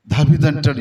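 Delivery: normal talking voice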